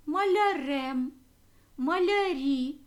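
малярем, малярі drawn out slowly, endings hit loud; slow, lean on the endings; teacher stressing the endings of малярем and малярі; stressed endings. Shown as a demonstration. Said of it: "закінчення наголошене".

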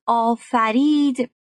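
آفرید stretched out very slowly, falling at the end; slow dictation. Shown as a demonstration. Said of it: "معانی دیگه ای رو هم براش آوردم". (آفرید را خیلی کشیده و آرام بگو)

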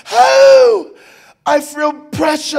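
high, loud, slow wail; mimicked labor wail. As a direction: wail as the wife in labor, drawing out the words. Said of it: "Just like that".

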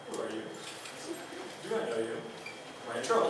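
put-on kid's wary thoughts. Lonely kid's imagined thoughts.

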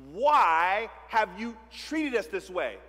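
slow, reproachful mother asking why. Acted. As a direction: play the hurt mother scolding her boy. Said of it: "she has the mother tone".

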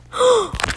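high, sudden gasp; shocked gasp. Real, not acted. Gamer seeing where they landed, shocked gasp. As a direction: gasp sharply in disbelief.

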